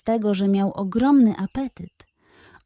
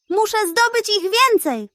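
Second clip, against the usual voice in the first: determined pug; 11 semitones higher than usual. Acted.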